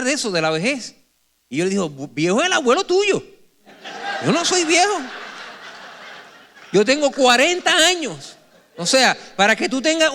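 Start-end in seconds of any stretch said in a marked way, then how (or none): 1.9-8.4 put-on higher, laughing protest that he isn't old; mock indignant protest. Father protesting to his daughter, mock indignant and laughing. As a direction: act offended through laughter.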